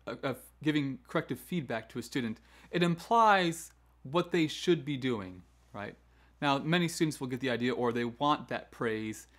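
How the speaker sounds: usual speaking voice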